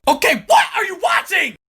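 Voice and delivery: baffled exclamation. Acted. Friend baffled by the show.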